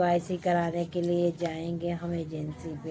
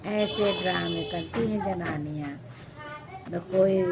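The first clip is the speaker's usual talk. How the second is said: ordinary talk, nothing marked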